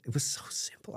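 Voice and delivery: a soft whispered echo of the line before. Real, not acted. To the interviewer, awed by the simplicity, whispering. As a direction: breathe it out as an unvoiced whisper.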